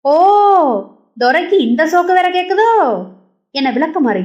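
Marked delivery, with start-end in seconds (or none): 0.0-2.7 angry mother. A narrator's reading. Mother raising broom at son.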